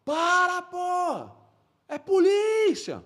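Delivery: high, strained warning yell; drawn-out high-pitched shout; re-enacted police shout. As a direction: call out to halt someone across a gunfight. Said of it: "comecei a fazer assim".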